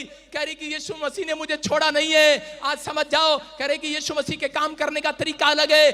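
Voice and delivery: loud, high, sustained sermon chant; rousing exhortation to the congregation; forceful preaching. Acted.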